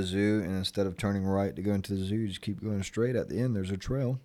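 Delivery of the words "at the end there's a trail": ordinary delivery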